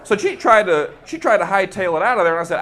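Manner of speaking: usual speaking voice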